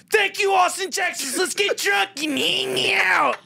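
high, slow stretched-out shout; mocking frontman shout. Friend mocking the band's closing line, slow high shout. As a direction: ham up a bandleader's sign-off. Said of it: "Why did you say it like that".

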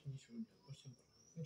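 ordinary delivery

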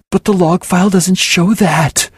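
high, loud desperate cry; cried out. A narrator's reading. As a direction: plead against the facts. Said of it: "I cried".

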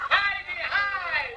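drawn-out high call; holiday-camp call. Shown as a demonstration. Host to the crowd, a slow, high holiday-camp call. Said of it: "on the count of three".

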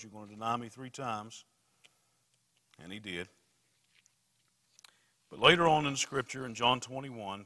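loud, driving sermon delivery; preaching at full force. Real, not acted. Preacher building up the story of Peter, loud preaching.

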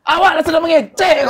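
high, loud Minang protest through laughter; hammed-up Minang holler; mock Minang shouting. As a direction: yell it indignantly, as if that is normal talking. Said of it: "Minang mah emang suka teriak-teriak kita".

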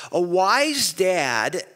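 "wise" drawn out, slow and rising; "wise" lengthened, lifted and slow; stress on "wise". Shown as a demonstration. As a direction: lean slowly into "A wise dad". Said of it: "repeated phrase, slow stress".

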